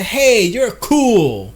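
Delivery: slow, easy "hey, you're cool"; a lightly voiced smooth talker; casual opener. Shown as a demonstration. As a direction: toss it off, relaxed and slow. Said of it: "And you say".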